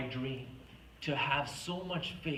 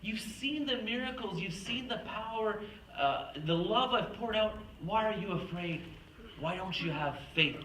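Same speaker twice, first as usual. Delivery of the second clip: higher, exasperated scolding; lightly voiced frustrated God who fades back to calm; 7 semitones higher than usual. God frustrated with his doubting people, mildly exasperated. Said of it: "kind of frustrated".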